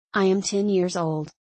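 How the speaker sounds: normal talking voice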